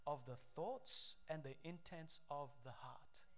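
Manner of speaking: hushed whisper, slow and intimate; slow reverent whisper. Acted. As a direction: lean in and whisper the key words. Scripture key phrase whispered.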